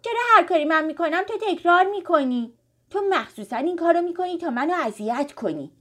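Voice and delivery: put-on high, loud child's accusation; angry. A narrator's reading. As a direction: snap crossly at a little sister. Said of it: "با عصبانیت گفت". (با عصبانیت)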